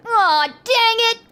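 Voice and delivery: high, slow, loud groaning curse; frustrated bandit. Acted. Bandit being arrested after all, hammed-up frustration. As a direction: draw it out in comic frustration. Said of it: "The voice says".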